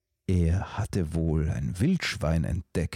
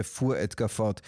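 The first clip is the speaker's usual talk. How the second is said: ordinary delivery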